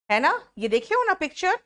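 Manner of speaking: ordinary delivery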